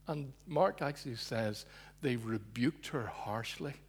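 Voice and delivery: ordinary talk, nothing marked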